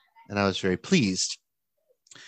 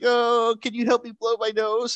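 put-on crying child; 11 semitones higher than usual. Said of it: "She starts crying again".

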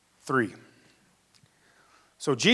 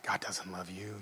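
a soft, much quieter taunting voice in your head; accusing inner voice; 4 semitones lower, 15 dB quieter than usual. Retold or imitated. Inner voice telling the believer he's worthless, soft and cold. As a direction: hush it down like a sneering thought. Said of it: "you'll hear things like".

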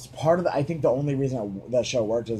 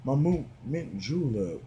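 dignified Southern; 3 semitones lower, 5 dB quieter than usual. Shown as a demonstration. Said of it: "the dignified southern accent".

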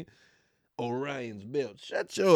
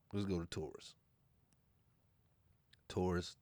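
usual speaking voice

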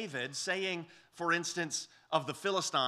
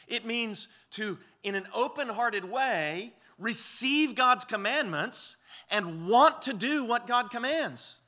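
higher, louder and slow, driving home the point; preaching at full force; 7 semitones higher, 5 dB louder, slower than usual. Acted. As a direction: preach it with rising fervor. Preaching climax.

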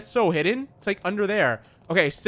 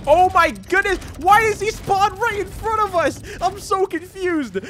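startled panic; 12 semitones higher, 4 dB louder, slower than usual. Real, not acted. Monster spawns right in front.

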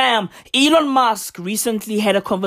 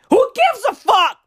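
shout; 9 semitones higher than usual. Real, not acted. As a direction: blow up at the whole question. Repeated outburst.

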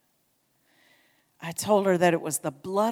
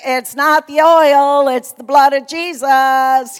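loud, slow, higher-pitched holler; hollering back; 5 semitones higher, 15 dB louder, slower than usual. Retold or imitated. Preacher answers lady across the room, slow loud holler. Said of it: "I hollered back".

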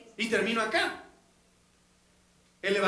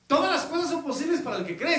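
higher, louder, fired-up challenge; lively retold Jesus voice, higher and louder; Jesus challenging; 7 semitones higher, 4 dB louder than usual. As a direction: preach it as a bold rebuke of doubt. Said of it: "Le dice".

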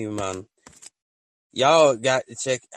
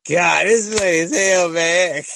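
amused; 7 semitones higher, 9 dB louder than usual. Retold or imitated. Retold laughing reaction.

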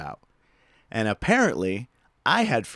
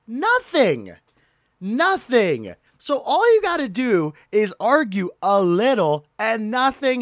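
high, loud outraged disbelief; ranting at the unfairness; indignant rant; 9 semitones higher, 4 dB louder than usual. Real, not acted. Telling the audience, high-pitched indignant disbelief.

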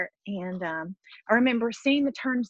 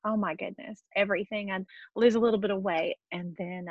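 ordinary talk

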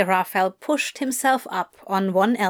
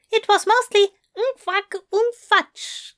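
mock sleep mumble; 12 semitones higher than usual. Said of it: "I do not sound like that".

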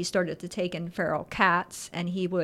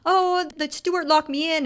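higher-pitched pretend helplessness; mock-distressed fib; 8 semitones higher than usual. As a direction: act out a ridiculous excuse. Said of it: "makes up some ridiculous lie".

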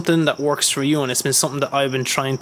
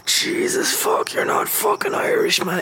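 much higher, strained mock outburst, slow; exasperated rant; slower than usual. Fed up with people claiming to be Irish, exasperated. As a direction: rub your face and groan it out in disbelief. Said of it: "I'd rub my face and like".

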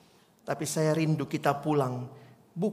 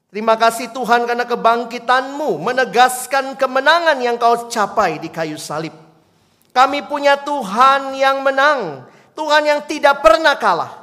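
loud, higher triumphant prayer; fervent prayer; 8 semitones higher, 12 dB louder than usual. Acted. Pastor leads the church in a victorious closing prayer. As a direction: pray with rising triumph.

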